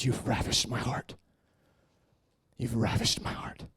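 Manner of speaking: low, hushed, slow words of love; soft, reverent near-whisper; tender declaration. Retold or imitated. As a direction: murmur it intimately, as God to a beloved. Voices God speaking tenderly to him.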